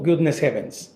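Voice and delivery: ordinary delivery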